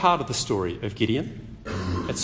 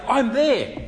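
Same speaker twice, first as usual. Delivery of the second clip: higher, a hammed-up superhero's eager reply; heroic Batman voice; 9 semitones higher than usual. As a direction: play the brave hero stepping up.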